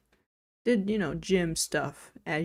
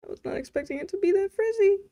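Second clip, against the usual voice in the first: high squeal of disbelief at her hair; surprised; 13 semitones higher, 4 dB louder than usual. Real, not acted. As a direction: pitch way up, amazed. Shocked at own frizzy hair.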